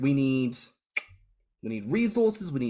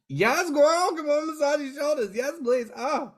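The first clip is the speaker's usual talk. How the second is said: much higher, louder, squealing yes; eager yes; 3 dB louder than usual. Retold or imitated. Host retelling eagerly accepting the massage, squealed high. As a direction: gush like a delighted friend. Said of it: "I'm like".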